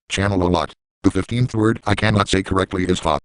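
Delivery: ordinary delivery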